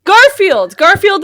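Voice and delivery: excited recall. Real, not acted. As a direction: blurt the name out in delight. Realizing the answer, exclaiming.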